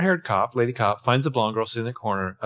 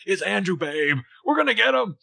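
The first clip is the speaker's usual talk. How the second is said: higher, mock-dramatic reading; hammed-up actor; 7 semitones higher than usual. Mocking Toby's actor showing how to play it, hammed-up. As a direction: ham it up like a thespian. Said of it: "he takes on".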